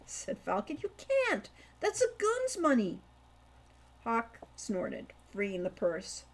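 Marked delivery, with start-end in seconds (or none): 0.8-3.0 high-pitched scolding younger brother; object, a little shocked; younger brother protesting. Acted. Younger brother scolding Hawk over the purse, high protest.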